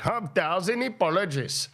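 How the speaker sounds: high, hammed-up Russian-style accent on "A thousand apologies"; mock North Russian accent. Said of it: "That could be North Russian".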